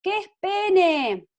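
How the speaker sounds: drawn-out, high-pitched shout across the house; retold child's yell. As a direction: imitate a girl calling out to mum, high and loud. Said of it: "mi hermana a los gritos desde su cuarto".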